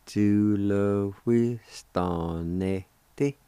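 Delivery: "Ju le wi stan ne ti" at half speed, syllables stretched; slow sounding-out. Shown as a demonstration. Teacher showing how job is pronounced, slow sounding-out. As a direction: draw out each syllable of Ju le wi stan ne ti slowly. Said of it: "if you say it slow".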